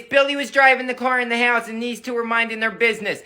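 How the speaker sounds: normal talking voice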